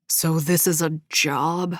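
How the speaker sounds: slow, lightly disdainful stranger; mildly scornful question. A narrator's reading. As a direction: let a hint of contempt through. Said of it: "didn't attempt to hide his disdain".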